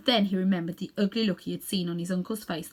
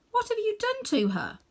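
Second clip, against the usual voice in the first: put-on alarmed boy. Boy confronting uncle.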